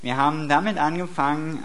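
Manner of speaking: normal talking voice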